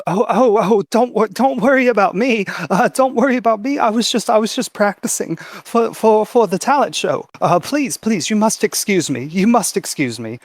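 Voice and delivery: high, stammering, panicky politeness; flustered young girl. Acted. Startled girl excusing herself to eavesdroppers, flustered and high. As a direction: stumble over repeated words in a high girl voice.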